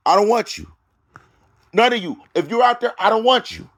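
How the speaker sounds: high, emphatic preacher's rebuke; forceful preaching. Acted. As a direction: preach a firm rejection, pitched high.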